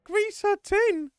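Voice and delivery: very high, loud and slow; shrieking repeat through laughter; amazed echo. Real, not acted. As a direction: stretch out the disbelief. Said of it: "Oh my God".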